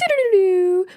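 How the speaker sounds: high "doo-doo-doo" fanfare; playful high-pitched drumroll fill; sung mock fanfare. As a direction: trumpet in an announcement with your voice. Mock fanfare before announcing contest.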